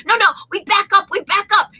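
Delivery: high, insistent mock Japanese accent. Japanese passenger arguing with the pilot, high Japanese accent. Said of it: "the heavy Japanese accent".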